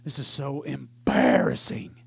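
low, mortified grumble to himself; sunk-in-embarrassment aside; embarrassed teenager. Retold or imitated. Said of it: "one of the most embarrassing moments".

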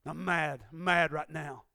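normal talking voice

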